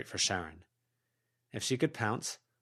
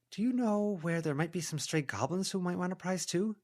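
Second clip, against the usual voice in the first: higher, coaxing character voice; heroine coaxing a goblin; 10 semitones higher than usual. A narrator's reading. Heroine persuading a goblin to hunt down traps, coaxing. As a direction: sweet-talk the goblin into helping.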